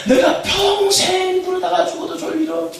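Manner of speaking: very high, loud, slow shout stressing 평생; shouted call. Shown as a demonstration. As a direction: cry it out for the crowd to answer. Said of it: "아, 이렇게 하신다는 거니까". (평생을 힘주어 높고 크게 외치기)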